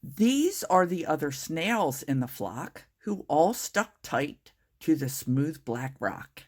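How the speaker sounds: usual speaking voice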